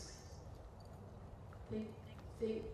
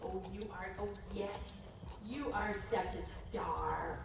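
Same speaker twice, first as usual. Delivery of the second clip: slow, soft, doting praise; slow adoring praise for the horse; tender praise; 3 semitones lower, 4 dB quieter, slower than usual. Real, not acted. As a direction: linger lovingly over every word. Praising horse after piaffe.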